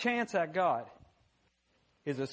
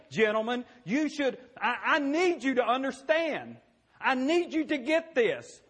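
rising frustrated rebuke, louder and higher; Jesus scolding; 7 semitones higher, 4 dB louder than usual. Retold or imitated. Preacher voicing Jesus rebuking the disciples, building frustration.

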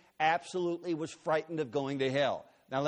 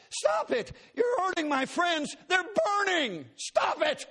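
very high, loud frantic screams; shrieking in panic; terrified screaming; 14 semitones higher, 4 dB louder, slower than usual. Retold or imitated. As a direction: scream as friends burn. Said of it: "she was screaming at me".

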